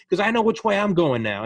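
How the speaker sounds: high, loud mock yell at protesters; imitating an angry shop owner yelling; retold yell. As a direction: shout it up at the rioters. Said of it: "he's yelling at the protesters".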